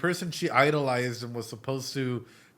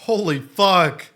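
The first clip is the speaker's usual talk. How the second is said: stunned exclamation; 5 semitones higher, 10 dB louder, slower than usual. Real, not acted. Stunned by the song's ending.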